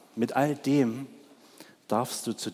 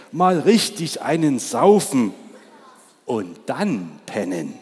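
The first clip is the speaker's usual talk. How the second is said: louder, boisterous ox voice; a coarse ox boasting; rowdy ox; 7 dB louder than usual. Acted. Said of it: "ergänzte der Ochse". (Der Ochse prahlt laut vom Trinken)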